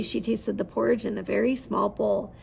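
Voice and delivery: ordinary delivery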